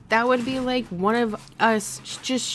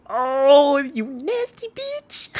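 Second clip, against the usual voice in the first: mock outrage; 5 semitones higher, 5 dB louder, much slower than usual. Real, not acted. Playful shocked insult to friend.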